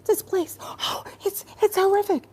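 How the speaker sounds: sarcastic high fake horror; mocking hand-wringer. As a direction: parody people wailing about the war. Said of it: "And they tell".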